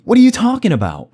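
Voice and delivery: loud, high-pitched mock disbelief; exaggerated indignant reaction; incredulous outburst. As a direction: blurt it out, utterly baffled. Acted disbelief at an apology.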